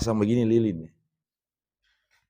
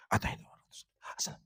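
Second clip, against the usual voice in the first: rushed recitation; 14 dB quieter than usual. Retold or imitated. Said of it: "Orang kasih cepat".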